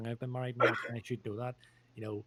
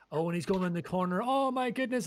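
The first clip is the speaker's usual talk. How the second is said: excited commentator; 8 semitones higher, 5 dB louder than usual. Pretend. Mock football commentary.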